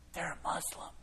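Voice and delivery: hushed, voiceless whisper. Retold or imitated. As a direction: lean in and whisper it secretly. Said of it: "she whispers".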